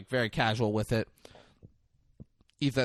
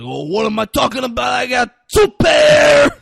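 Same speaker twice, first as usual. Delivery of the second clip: hyped-up yell; 12 semitones higher, 14 dB louder than usual. Retold or imitated. Acting out Tuco answering the card table, building hyped yell. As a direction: build from indignant to exploding. Tuco bellowing his hand again.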